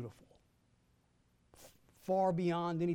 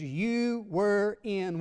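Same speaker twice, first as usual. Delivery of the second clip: very slow, loud, high build-up; drawn-out buildup; 5 semitones higher, 5 dB louder, much slower than usual. Acted. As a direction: stretch each word to build suspense. Slow drawn-out scripture quote.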